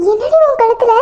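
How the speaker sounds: loud, high cry of shock at the chain round her daughter's neck; mother shouts in shock at her daughter. Acted. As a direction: shout it in alarm. Mother sees the wedding chain.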